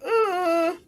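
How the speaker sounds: high, drawn-out noise of disapproval; mock disapproving "uh". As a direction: imitate girls put off by a remark. Imitates girls' disapproving reaction.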